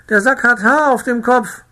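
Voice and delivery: high, loud, terrified shriek; shrieking woman. A narrator's reading. The farmer's wife shrieks that the sack has hair. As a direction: scream it out in horror. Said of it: "kreischte die Frau".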